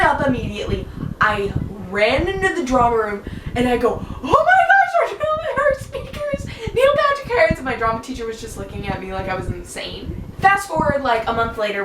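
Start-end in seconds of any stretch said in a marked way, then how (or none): 4.2-7.6 high-pitched fangirl squeal, bursting out; hysterical excitement. Retold or imitated. Student running in to tell the drama room, hysterically excited. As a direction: shriek the big news in a frenzy. Runs in, freaking out over guest.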